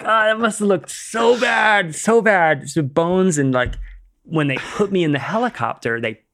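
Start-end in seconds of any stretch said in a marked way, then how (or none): 0.0-2.7 high, slow pitying voice; higher, louder "so bad" with put-on sympathy; mock pity. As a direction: coo it like a shocked onlooker. Mock sympathy at own wrecked feet.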